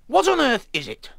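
high, loud indignant outburst; storming. A narrator's reading. Pete Pepper confronts the terrible noise, storming. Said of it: "stormed Pete Pepper".

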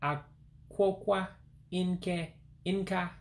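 very slow, high and careful; over enunciating. Shown as a demonstration. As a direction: draw each syllable out. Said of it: "One more time".

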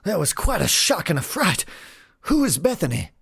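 high, flustered showman's voice; startled ringmaster. Acted. Ringmaster to the man towering over him, high and startled. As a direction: play a rattled circus ringmaster.

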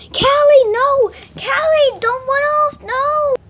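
high, loud shouts after a runaway dog; urgent pretend calling. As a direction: yell the dog's name to make it stop.

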